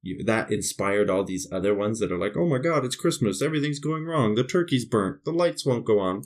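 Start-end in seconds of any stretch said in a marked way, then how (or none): 2.4-6.2 higher-pitched movie-character fretting; mock panic. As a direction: list disasters in mock dismay. Mimics frantic Christmas film characters.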